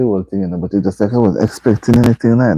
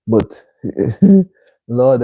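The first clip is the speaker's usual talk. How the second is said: ordinary delivery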